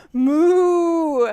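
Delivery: long, loud cow moo; shouted moo. Acted. Little bird trying again after pig and dog, shouted moo. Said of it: "so he shouted".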